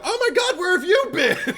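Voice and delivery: very high, loud, laughing squeal; delighted shriek. Retold or imitated. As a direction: shriek with joy at a long-lost friend. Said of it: "I was like".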